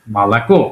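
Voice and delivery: "মালাকো" low, lifting onto a stressed final "ko"; stress on last syllable. Shown as a demonstration. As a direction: put the weight on "ko" at the end of "মালাকো". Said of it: "শেষের অংশে জোর পড়েছে".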